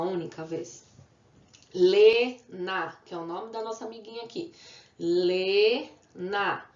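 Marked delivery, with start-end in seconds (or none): 1.7-3.0 Lena loud, as a long Le, then na; syllable by syllable. Shown as a demonstration. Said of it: "Duas sílabas". 5.0-6.7 Lena with Le held long, then na; syllable by syllable. Shown as a demonstration. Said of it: "Duas sílabas".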